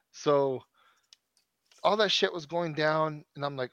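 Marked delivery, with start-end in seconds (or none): none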